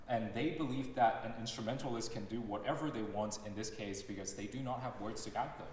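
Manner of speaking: ordinary talk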